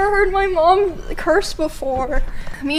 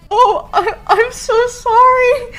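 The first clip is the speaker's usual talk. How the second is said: mock apology; 9 semitones higher, 4 dB louder, slower than usual. Apologizing to grandma.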